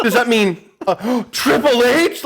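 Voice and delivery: high, drawn-out mock gasp of realization; gasp, then stretch the name out high; mock voiceover. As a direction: imitate a streamer's exaggerated shock. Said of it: "the guy was so fucking funny".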